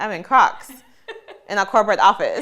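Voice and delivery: laughing through the words; half-laughing at herself; laughing disbelief. Retold or imitated. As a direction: giggle out the punchline. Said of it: "And I was like".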